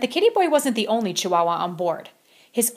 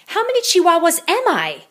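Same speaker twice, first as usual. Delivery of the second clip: wondering aloud; 9 semitones higher, 7 dB louder than usual. Acted. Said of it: "he wondered out loud".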